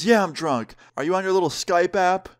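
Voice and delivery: a hint of tipsy mockery from a mom; drunk mom. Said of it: "She is absolutely hammered".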